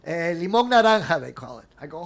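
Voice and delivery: normal talking voice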